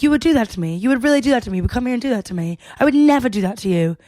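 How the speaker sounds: Jess's hurt outburst in a put-on accent; quick, accusing retelling in an imitated accent; put-on accent, upset. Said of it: "are my accents good?".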